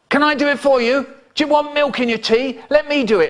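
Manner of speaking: high, fast eager little helper; eager child voice. Retold or imitated. Child begging mum to let him pour the milk, high and eager. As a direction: pipe up like a keen kid desperate to help.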